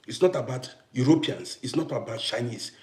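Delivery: ordinary delivery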